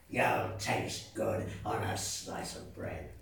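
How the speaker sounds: low, deep monster voice; hungry Gruffalo. Acted. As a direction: rumble it like a big beast.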